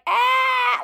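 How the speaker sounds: high-pitched mock squeal; imitated squeal. Grandkids reacting to the couple kissing, imitated squeal.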